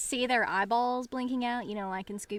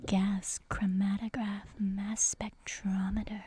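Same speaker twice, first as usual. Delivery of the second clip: reverent science whisper. Pretend. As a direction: whisper it like a sacred phrase. Mock-awed science whisper.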